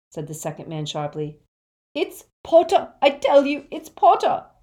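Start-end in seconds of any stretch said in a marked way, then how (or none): 1.9-4.5 very high, loud, frantic sobs; anguished, high-pitched cry; sobbing insistence. A narrator's reading. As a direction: wail it, begging to be believed. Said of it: "sobbed Xenophilius".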